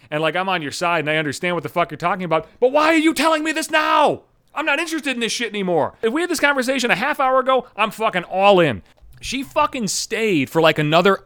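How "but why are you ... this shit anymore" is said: exasperated shout. Retold or imitated. Venting at the ex on the couch.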